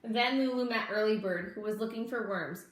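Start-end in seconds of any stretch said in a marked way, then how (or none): none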